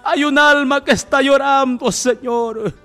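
loud, high, impassioned plea; crying out in prayer. Real, not acted. Telling God of a weeping soul, loud pleading cry. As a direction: call it out to God with full force.

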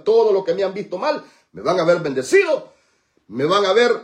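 loud, high, insistent proclamation; triumphant declaration. Real, not acted. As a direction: drive the promise home. Leads congregation in declaration.

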